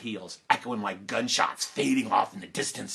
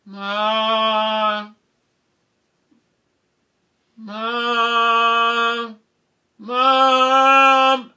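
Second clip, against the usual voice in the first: long, high, sustained wail; calling out for Mom; 9 semitones higher, 9 dB louder, much slower than usual. Retold or imitated. Child feigning illness in bed calls Mom, wailing call. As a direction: call through the house in one drawn-out high note.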